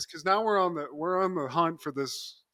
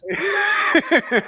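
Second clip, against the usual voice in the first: high, loud shouted laugh; real laughter; 11 semitones higher, 7 dB louder than usual. Real, not acted. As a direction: burst out laughing loudly. Big laugh at joke.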